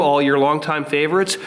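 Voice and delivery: ordinary delivery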